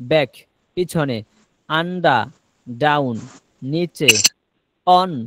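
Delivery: word-by-word drill with clear pauses; careful dictation, each item set apart by a pause; pronunciation demo. Shown as a demonstration. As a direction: model each word separately for learners to copy. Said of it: "একটু উচ্চারণ করে দিতেছি".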